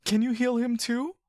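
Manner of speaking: put-on a child begging his friend for help; pleading boy. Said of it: "you say".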